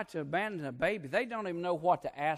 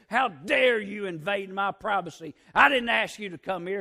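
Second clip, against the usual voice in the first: louder, higher indignant outburst; mad; 4 semitones higher, 8 dB louder than usual. Retold or imitated. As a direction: act out an offended homeowner, loud and raised. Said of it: "They got mad and said".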